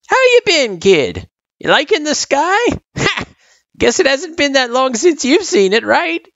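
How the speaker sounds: put-on high, chuckling old-timer; old turtle, jovial. A narrator's reading. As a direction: greet the kid as a jolly old turtle.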